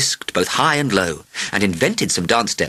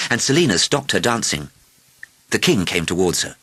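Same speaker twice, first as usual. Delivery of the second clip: usual speaking voice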